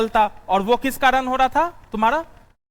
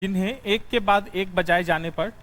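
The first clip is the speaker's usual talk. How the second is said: dictating notes. Acted.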